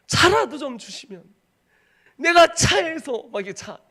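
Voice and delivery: high, loud cry breaking into sobs. Retold or imitated. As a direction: sob the plea out loud to God. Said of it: "막 울음이 나오는 거야". (울먹이며 외치듯)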